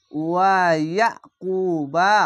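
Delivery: ওয়া ইয়াকুবা slow, ku held one alif; elongated recitation. Shown as a demonstration. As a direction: chant ওয়া ইয়াকুবা with the long u. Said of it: "এক আলিফ টেনে পড়বেন". (টেনে পড়া ওয়া ইয়াকুবা)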